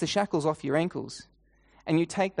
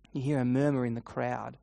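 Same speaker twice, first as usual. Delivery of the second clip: ordinary delivery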